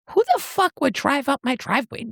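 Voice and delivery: high-pitched indignant disbelief. Acted. Heroine reacting to a car in her driveway, high and indignant.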